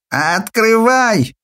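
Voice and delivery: put-on high, slow drunken shriek; shrill drunk voice. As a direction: bellow it like a drunk pounding the door. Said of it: "раздался за дверью визгливый пьяный голос".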